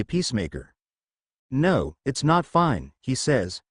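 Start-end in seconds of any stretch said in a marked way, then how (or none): none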